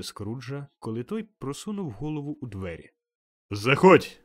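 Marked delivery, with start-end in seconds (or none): 3.5-4.2 loud, hearty shout from a spirit; booming call. A narrator's reading. The ghost calling Scrooge in from the doorway, booming call. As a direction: call out with full power. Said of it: "гукнув привид до Скруджа".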